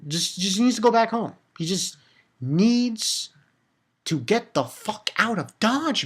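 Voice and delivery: ordinary talk, nothing marked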